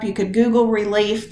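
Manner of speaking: ordinary delivery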